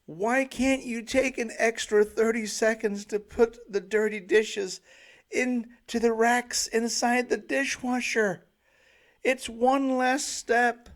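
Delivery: loud, high anguished bellow, slowing at the end. Retold or imitated. Parent bellowing at kids about dirty dishes. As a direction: bellow it in despair. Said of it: "bellowing in anguish".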